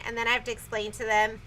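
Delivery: ordinary delivery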